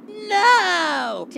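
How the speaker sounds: high dismayed "No!"; dismayed cry. Acted. Dismay at song meaning.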